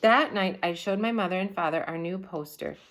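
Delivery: usual speaking voice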